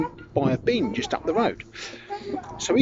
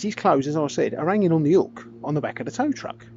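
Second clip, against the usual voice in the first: usual speaking voice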